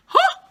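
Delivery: high loud startled huh; shocked gasp. Real, not acted. Viewer startled by Avi's line, a loud high gasp. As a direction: gasp out in delight.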